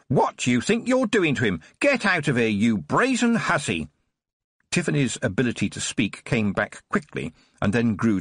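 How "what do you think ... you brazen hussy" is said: screaming. Acted. Nurse screams at Tiffany to get out, outraged and shrill. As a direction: scream her out of the room.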